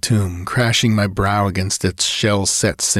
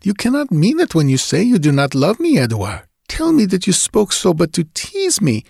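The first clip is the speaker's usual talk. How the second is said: much higher, rising, desperate plea; pleading Julie; 9 semitones higher than usual. Acted. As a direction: beg him to come back. Julie keeps begging.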